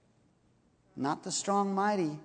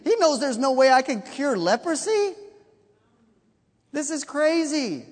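higher and louder, half-laughing alarm; hammed-up royal panic; mock panicked king; 7 semitones higher, 4 dB louder than usual. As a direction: play the king freaking out, voice up and loud. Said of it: "the king reads it and freaks out".

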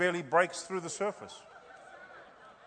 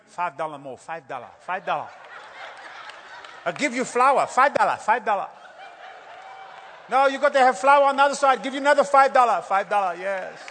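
a hammed-up salon worker's broken English, pitched up and loud at the end; nail salon worker; 3 semitones higher, 5 dB louder than usual. Nail salon worker pushing extras on a customer, mock accent.

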